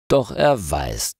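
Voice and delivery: usual speaking voice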